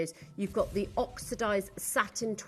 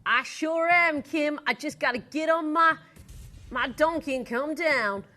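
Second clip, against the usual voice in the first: a mock Southern cowgirl voice, opening a touch louder; Jessie the cowgirl; 3 semitones higher, 3 dB louder than usual. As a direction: play the cowgirl riding in on her donkey, in a country accent. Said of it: "Jessie, are you gonna join us".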